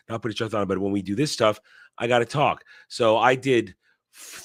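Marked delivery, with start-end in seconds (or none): none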